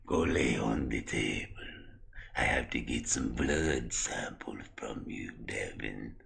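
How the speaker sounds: very slow, low, soft creepy voice; sinister drawn-out doctor drone; Victor. Acted. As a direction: stretch every word out eerily. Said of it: "why do you talk like that".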